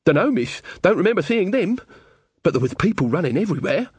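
high-pitched simple countryman; flustered villager witness. Acted. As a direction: answer the lady in a high rustic voice. Weaver answers Magrat's questioning.

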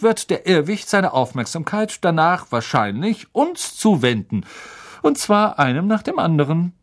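a friendly professor coaxing a shy pupil; Professor Lupin voice. Acted.

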